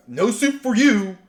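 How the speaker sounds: hammed-up stern soup Nazi. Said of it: "soup Nazi kind of".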